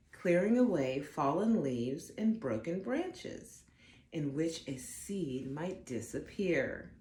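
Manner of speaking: ordinary delivery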